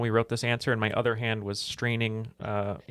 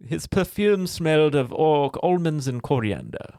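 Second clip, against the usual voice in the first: weird stage accent, slow and raised in pitch; Mister Collins accent; 5 semitones higher, 4 dB louder, slower than usual. Shown as a demonstration. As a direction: deliver it slowly in a hammed-up, odd accent. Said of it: "do the line that I love".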